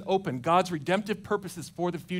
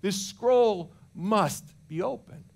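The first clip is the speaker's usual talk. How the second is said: distraught John; 4 semitones higher, much slower than usual. Retold or imitated. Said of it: "He's an emotional wreck".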